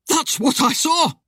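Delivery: high-pitched, panicked outburst; frightened cry. A narrator's reading. Terrified husband to his wife, crying out. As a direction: cry it out in alarm, pitch jumping up. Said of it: "Timmy cried".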